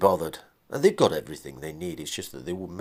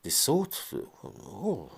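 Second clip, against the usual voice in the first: usual speaking voice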